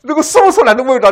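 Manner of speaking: high, loud cry of inner turmoil; anguished. Real, not acted. Preacher recalling his torment, crying out high and loud. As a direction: pour out the torment to the congregation. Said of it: "我那时候真的要哭出来了".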